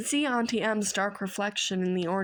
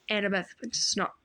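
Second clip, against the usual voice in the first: ordinary delivery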